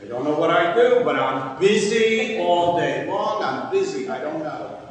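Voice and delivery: loud, exaggerated protest, stretching out the busyness; comedian's routine. Comedian explaining what he does all day, mock-exasperated. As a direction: play it as a stand-up punchline. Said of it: "all the comedians have the same routine".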